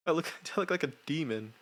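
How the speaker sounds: giggly disbelief at yourself; amused laughing. Real, not acted. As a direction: talk through the tail of a laughing fit. Still laughing at own footage.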